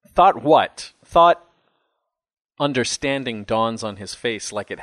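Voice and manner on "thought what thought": pressing question. Acted. Father pressing his confused son.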